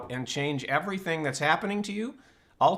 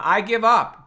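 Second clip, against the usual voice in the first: louder, higher burst of a mock surrender; mock despairing outburst; 5 semitones higher, 7 dB louder than usual. Speaker to workshop audience, retelling his mind's complaint, a mock exasperated blurt. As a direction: throw up your hands and blurt it out, half-joking. Quoting own despairing inner voice.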